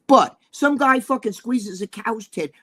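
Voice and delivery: ordinary delivery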